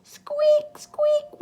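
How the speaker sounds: high thin squeaks; squeaky lantern. Acted.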